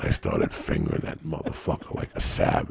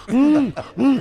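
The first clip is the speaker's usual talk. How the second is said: loud, high muffled hums; muffled moaning; 8 dB louder than usual. Retold or imitated. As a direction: hum high as if the mouth is full. Imitates woman with her mouth full.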